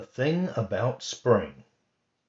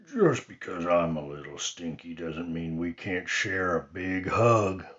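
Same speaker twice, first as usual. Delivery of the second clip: sleepy Bear; 3 semitones lower than usual. Acted. Bear offering Rabbit a hug, lightly voiced sleepy bear. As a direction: rumble it like a drowsy bear. Bear speaks after waking.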